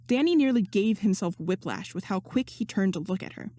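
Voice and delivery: ordinary delivery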